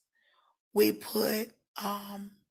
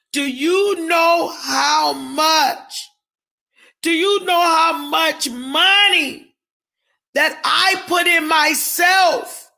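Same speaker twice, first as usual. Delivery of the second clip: slow, higher and louder, hammering it home; indignant shout; 4 semitones higher, 5 dB louder, slower than usual. Real, not acted. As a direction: demand an answer, outraged. Defending her own spending.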